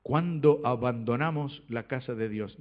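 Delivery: slow, measured, words spaced out; deliberate, unhurried restatement for emphasis. Shown as a demonstration. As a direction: let each word sink in slowly. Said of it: "lo voy a decir más despacito".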